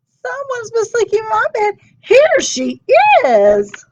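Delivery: put-on tiny bear discovering the girl; squeaky Baby Bear. Baby Bear finds Aluki in her bed, squeaking. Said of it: "and squeaked".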